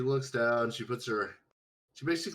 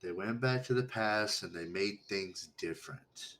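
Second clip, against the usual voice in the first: put-on reading to children; 2 semitones lower than usual. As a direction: tell it like a bedtime story. Storytelling to simple character.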